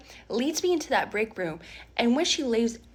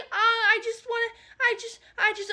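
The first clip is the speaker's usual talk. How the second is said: nervous stammer; 11 semitones higher than usual. Retold or imitated. Stacy stammering high voice.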